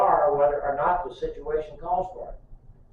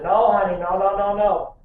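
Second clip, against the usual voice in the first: louder, higher urgent nos; 5 semitones higher, 6 dB louder than usual. Retold or imitated. Father stopping his toddler, urgent raised no. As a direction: cry out no to stop the child. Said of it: "So I said".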